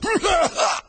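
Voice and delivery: loud, high strangled splutter; high choking whinny; choking horse noise. A narrator's reading. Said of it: "there it choked".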